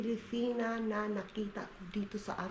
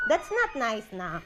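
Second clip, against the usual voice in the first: sharp scolding; slower than usual. Real, not acted. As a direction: tell him off firmly. Mother scolding son roughing sibling.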